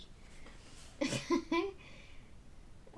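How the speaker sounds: breathy trailing laugh; amused little laugh; quiet chuckle; soft giggle. Real, not acted. Laughs after Cockney example.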